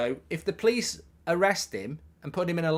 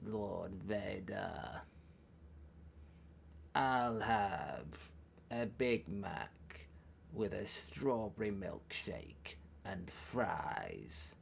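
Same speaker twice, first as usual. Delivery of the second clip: very soft, low, slow croak; hushed, drawn-out dark lord impression; Palpatine; 7 semitones lower, 11 dB quieter, much slower than usual. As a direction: intone each phrase low with long pauses. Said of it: "I can do it like this".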